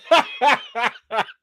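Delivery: high helpless giggling fit; real guilty laugh. Real, not acted. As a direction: keep cracking up despite yourself. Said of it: "I shouldn't laugh".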